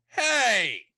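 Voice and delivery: sudden high, loud "Hey!"; indignant shout. Acted. [name] yelling in protest at the pie. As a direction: blurt out an outraged "Hey!".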